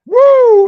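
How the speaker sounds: loud, high "woo!"; celebratory whoop. Retold or imitated. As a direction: let out a triumphant whoop. Celebrating his score in a retold story.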